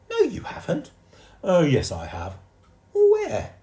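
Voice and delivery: put-on very high, loud childish squeak; shrill disbelief from a little boy; indignant boy. A narrator's reading. Jeremy James arguing.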